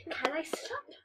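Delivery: cheeky whispered question. Retold or imitated. Kid whispering Sean's cheeky line, unvoiced. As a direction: breathe it out as a naughty secret.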